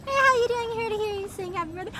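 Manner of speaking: ordinary delivery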